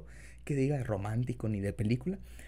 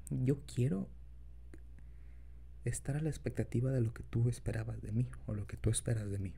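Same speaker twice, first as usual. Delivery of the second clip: put-on soft, low boyfriend voice; tender, shy lover, quiet; incubus; 5 semitones lower, 6 dB quieter than usual. Continues confession to partner.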